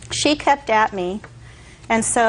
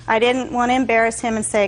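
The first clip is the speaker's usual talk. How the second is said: ordinary talk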